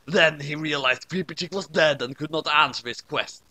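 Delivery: ordinary delivery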